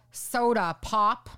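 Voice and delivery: "soda pop" said slowly as a regional word; drawn-out soda pop. Shown as a demonstration. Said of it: "some people call".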